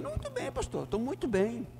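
mimicking the counselee. Pastor voicing the man he counselled, high mocking imitation. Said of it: "E eu disse".

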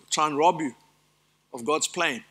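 ordinary talk

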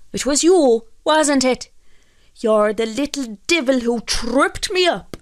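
loud, high-pitched accusing voice; angry accusation. A narrator's reading. Fisherman accuses the little man of tripping him, angry. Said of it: "called out Michael angrily".

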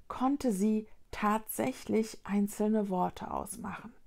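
ordinary delivery, nothing marked

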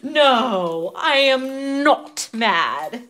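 slow, drawn-out mock calm; sarcastic denial. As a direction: insist you're not mad, very slowly. Denying being mad.

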